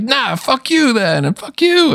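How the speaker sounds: high, drawn-out mock taunt; mock defiance. Podcaster imitating either side of the rivalry, high-pitched mock defiance. Voices both sides trading insults.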